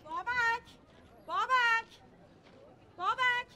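put-on loud, high shouts for a missing child; urgent high-pitched yell; calling out. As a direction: shout the name out to find your child. Frantically calling for her child.

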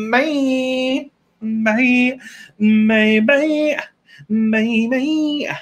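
high, drawn-out repeated goodbyes; singsong farewell. Real, not acted. Streamer signs off to viewers, high singsong farewell. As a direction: chirp each one up high, stretched out.

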